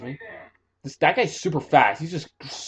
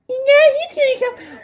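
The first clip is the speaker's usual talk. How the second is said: panicked yell; 6 dB louder than usual. Real, not acted. Panicked gamer spotted.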